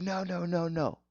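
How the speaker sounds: high-pitched imitation of angry nos; retold string of protesting nos; mad refusal. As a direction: cut them off, imitating a mad leader. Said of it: "He was so mad".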